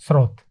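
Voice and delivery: स्रोत as a short "srot", falling; correct pronunciation. Shown as a demonstration. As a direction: join half s to "rot" in स्रोत. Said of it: "स आधा है".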